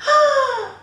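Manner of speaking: loud, high gasp of astonishment; sharp storyteller's mock gasp; startled gasp. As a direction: gasp as if a fish just spoke. Fisherman hears the fish talk.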